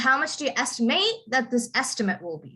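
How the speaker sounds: verb "estimate" ending in a rising full "ate", noun "estimate" front-stressed; noun-verb stress contrast. Shown as a demonstration. Teacher showing a student how to say the sentence, noun-verb stress contrast. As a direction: model "estimate" as verb with stressed end, then as noun with stressed start. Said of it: "So you would say".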